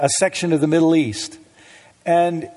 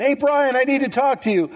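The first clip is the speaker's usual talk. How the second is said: calling out; 8 semitones higher than usual. Retold or imitated. Neighbor calling out to him.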